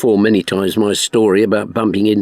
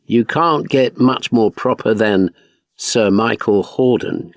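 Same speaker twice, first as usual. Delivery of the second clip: usual speaking voice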